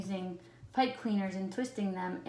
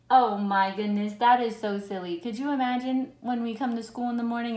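plain reading voice

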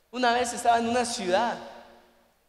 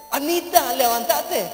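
loud, high insistent call; urgent wake-up call; 4 semitones higher, 6 dB louder, slower than usual. Acted. Brother urging his sleeping sister up, calling loudly. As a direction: get her out of bed.

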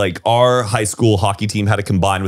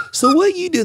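Houston Texan twang, pitched way up and pointed; a nosy Houston question, high and accusing; accusatory Houston accent. Retold or imitated. Guest mocking Houston locals, a high accusatory twang. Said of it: "Houston gets more, like, accusatory".

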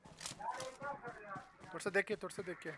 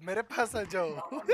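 usual speaking voice

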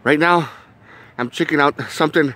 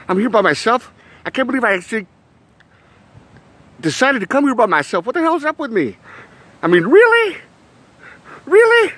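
acted panic; 10 semitones higher than usual. Spooked at being here by himself, high panicky disbelief. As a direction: play it spooked and incredulous, pitch climbing.

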